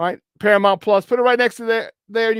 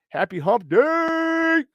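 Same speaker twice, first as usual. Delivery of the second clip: high, drawn-out celebratory call; slow, high-pitched show-host holler; hyped greeting; 9 semitones higher, much slower than usual. Real, not acted. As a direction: stretch "day" out long and high. Hyped greeting to chat viewers.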